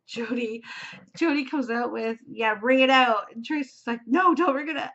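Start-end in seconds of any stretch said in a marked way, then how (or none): 2.3-3.3 louder mock advice, punched out; retold call-out. Vlogger retells Jody advising to wring out lace, retold call-out. 4.1-4.9 higher, louder retold protest; mock alarm. Vlogger retells Tracy stopping Jody's bad advice, mock alarm.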